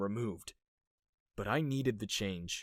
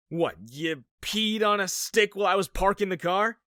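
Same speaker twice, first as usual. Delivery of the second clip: blurted out; 8 semitones higher, 5 dB louder than usual. Acted. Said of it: "I blurted out".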